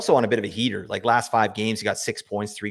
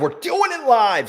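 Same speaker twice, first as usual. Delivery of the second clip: much higher and slow, hammed up; TV anchor catchphrase; 14 semitones higher, 5 dB louder, slower than usual. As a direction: belt it like a blustery TV host. Catchphrase echo of own line.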